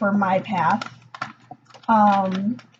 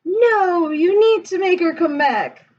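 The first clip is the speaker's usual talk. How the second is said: upset demand; 7 semitones higher than usual. Retold or imitated. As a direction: push back hard like a frantic mother. Demeter demanding from Zeus.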